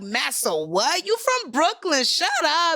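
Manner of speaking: high, slow teasing Brooklyn voice; mock Brooklyn guy. Brooklyn local teasing her about where she's from, in a high mock voice. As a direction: playfully insist like a Brooklyn local. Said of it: "they be like".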